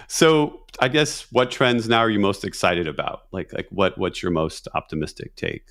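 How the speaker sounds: ordinary talk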